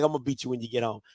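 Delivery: very low, quiet, imitated mother's threat; deadpan threat. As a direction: mimic mom's cold, understated warning. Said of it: "looks at me dead in my eyes and says".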